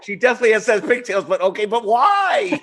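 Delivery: high, loud, incredulous mock voice; mock baffled reply. Host mimicking her confused reaction to executive, high mock voice.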